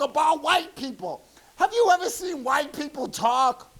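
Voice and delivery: very high, soft, thin comic voice; Richard Pryor impression. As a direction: talk like a burned-up comedian, high and soft. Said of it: "like Richard Pryor on Fire".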